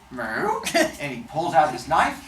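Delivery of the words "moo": short high moo imitating a cow; high-pitched animal imitation. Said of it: "And the cow goes".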